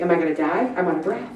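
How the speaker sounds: low, soft worried thought, lightly acted; quietly fretting to oneself, mildly anxious; nervous inner voice. Exerciser thinking they are out of breath, nervous. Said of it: "It makes me a little nervous".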